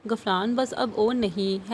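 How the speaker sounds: ordinary delivery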